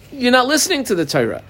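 high, quick scolding of the people; rebuking. Acted. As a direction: chide them for not listening.

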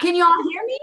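loud, high imitation of shouting into a phone; mock yell to be heard on video; screaming into phone. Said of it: "I was screaming into the phone".

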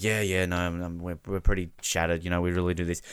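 low, soft, deflated mumble; imitating shattered player. Player after a lost semi, low and deflated. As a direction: mimic a crushed player, quietly. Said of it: "it's like".

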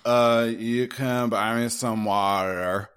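put-on slow, loud drunken slur; drunk impression. Trashed stranger at a bar answering a drink offer, drunken drawl. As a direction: drag the words out like someone wasted. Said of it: "wasted, completely trashed".

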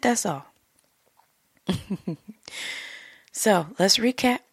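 ordinary talk, nothing marked

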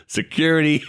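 shouted high and loud, hammed-up; playful imitated yell for security; mock yell. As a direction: bellow it like a startled rock star calling guards. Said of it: "[name] just yelled".

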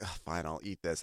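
low, muted retold resignation; grudging acceptance. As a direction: give in reluctantly, very quietly. Retold grudging acceptance of wrong order.

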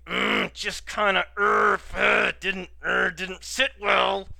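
slow, halting growly grunts between words; groaning displeasure. Pretend. Podcaster bothered by the bird sounds criticism, groaning displeasure. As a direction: grunt and struggle through the complaint. Groaning over the part that rankled.

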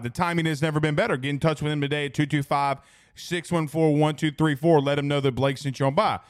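brisk sponsor plug; quick, upbeat sponsor spot; ad read. Acted. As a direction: rattle off the ad like a radio read. Reads sponsor ad.

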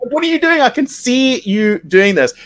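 mock outraged squeal; shrill retold outburst. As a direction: voice shoots up in disbelief. Retold blowup, pitch leaps.

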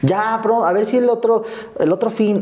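high, breezy phone voice; mother calling her son, high and breezy. Retold or imitated. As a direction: brush it off lightly, all cheer. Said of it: "mother's phone excuses".

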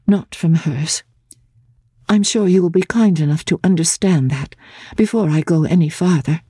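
Laura gathering courage, stumbling a little; careful, earnest plea to her fiancé; Laura, nervous but firm. A narrator's reading. Laura speaks to Sir Percival.

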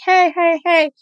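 high sing-out hello; cheery greeting. Retold or imitated. Greeting people you know at sunset, playful call. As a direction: call out to friends you keep bumping into.

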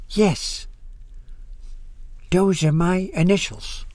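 put-on careful English with a foreign accent. A narrator's reading. Old woman shows pastor her initials, gentle foreign accent. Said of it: "in accented English".

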